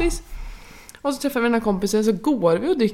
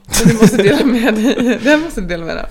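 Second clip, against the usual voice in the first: loud, stretched out through laughter; laughing demand; 8 dB louder than usual. Real, not acted. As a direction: insist loudly while cracking up. Teasing demand while laughing. (skrattande, högljudd uppmaning)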